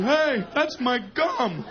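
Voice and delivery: play-acted indignant call; kid's protest. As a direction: object like a put-upon little batter. Kid batter protesting to crowding fielders.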